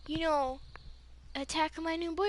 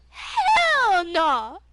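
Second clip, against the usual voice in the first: loud, high, drawn-out retort; acted-out indignant yell; outraged outburst; 6 semitones higher, 13 dB louder than usual. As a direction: belt it out in protective outrage. Reacting to attack on boyfriend.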